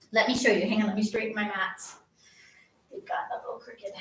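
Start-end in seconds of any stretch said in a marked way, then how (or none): 2.9-3.9 whispered aside. Real, not acted. Muttered aside while fixing mats.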